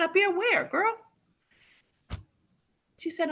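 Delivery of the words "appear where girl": much higher, squeaky disbelieving question; can't believe what she just heard; stunned disbelief. Retold or imitated. Stunned reply to producer.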